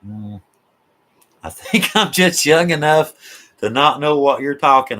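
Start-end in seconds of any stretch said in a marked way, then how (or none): 1.4-5.0 laughing. Real, not acted. Laughing fit on a livestream.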